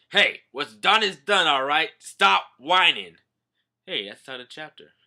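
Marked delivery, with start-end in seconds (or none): 0.1-3.2 loud, slow scolding; stern Zoro. Retold or imitated. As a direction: tell him to quit whining. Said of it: "Zoro's like".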